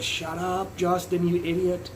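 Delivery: the neighbours snapping at him through the wall, half-joking; imitating annoyed neighbours. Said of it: "My neighbors are like".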